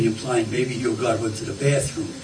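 normal talking voice

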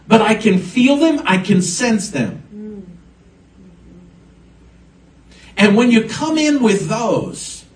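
full-force pulpit preaching; impassioned preaching. Acted. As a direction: lift the congregation with rising fervor. Preaching about sensing burdens.

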